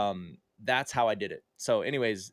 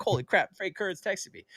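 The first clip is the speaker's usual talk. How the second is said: excited; 8 semitones higher than usual. Retold or imitated. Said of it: "I'm all excited".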